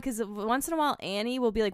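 ordinary talk

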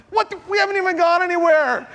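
high, strained cry of disbelief; breathless disbelief. Retold or imitated. To his friend while paddling, high strained disbelief. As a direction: pitch it up in exhausted disbelief. Exhausted surfer exclaiming far out.

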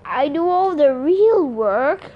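slow, indignant snake complaint; George, demanding. A narrator's reading. Male snake complains about his workload, slow and demanding. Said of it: "George demanded".

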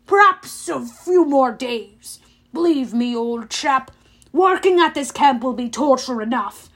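drip with insincere sympathy; smug consoling of an old chap; false sympathy. A narrator's reading. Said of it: "his voice dripping with false sympathy".

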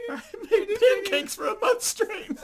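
laughing near tears. Real, not acted. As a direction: gasp it out through laughter. Laughing-crying at the absurdity.

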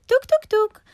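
high, loud knock-knock sound; knocking noise. A narrator's reading. Rabbit knocks on hare's door, high vocal knocks. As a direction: imitate sharp door knocks with the voice.

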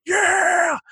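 high-pitched drawn-out mock cheer; crowd cheer. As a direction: holler back like a whole audience. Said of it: "they're like".

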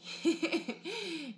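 a bright, high burst of laughter. Real, not acted. Friend laughing after calling her dumb.

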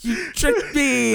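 high and slow, stretched laughing whine; drawn-out squeal. Real, not acted. Cohost repeating the complaint slowly in a high squeal. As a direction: draw it out, still in disbelief.

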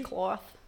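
"washcloth" with an added r, "worshcloth"; grandmother's pronunciation. Retold or imitated. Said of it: "My grandmother says".

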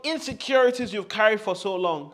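high and loud, building fervour; fervent, shouted affirmation; loud fervent declaration. Shown as a demonstration. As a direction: preach it with full force. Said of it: "As loud as you can".

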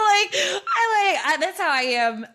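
high burst of laughter; laughing. Real, not acted. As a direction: laugh through the words.

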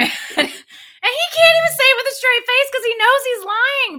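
high-pitched, exasperated complaint; real wound-up frustration breaking out; worked up. Real, not acted. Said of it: "it works me up so bad".

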